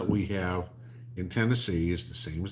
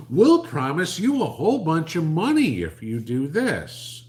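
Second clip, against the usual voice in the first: mock politician; 9 semitones higher, 9 dB louder than usual. Mocking the administration's offer.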